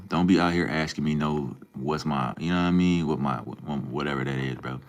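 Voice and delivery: ordinary delivery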